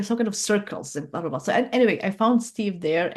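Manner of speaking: ordinary talk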